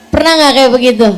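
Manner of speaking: loud shouted question; calling out. Real, not acted. Preacher shouting a question to the mothers, loud. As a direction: shout it out to the whole room. Calls out to congregation.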